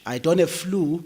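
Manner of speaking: ordinary talk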